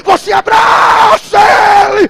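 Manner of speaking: shouted, building preacher's cry; preaching at full force. Acted. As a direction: preach it out at the top of your voice.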